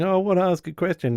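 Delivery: put-on high whiny kid asking a question; mock innocent child voice; stalling kid. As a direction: play the kid stalling bedtime. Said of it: "the kids' bedtime stalling tactic of going".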